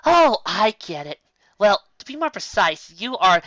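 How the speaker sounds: sudden realisation, pleased; bright eager explanation; lit up. Acted. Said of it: "her eyes lit up with a solution".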